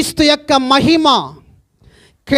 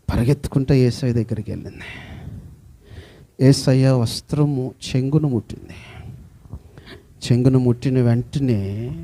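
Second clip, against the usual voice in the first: ordinary delivery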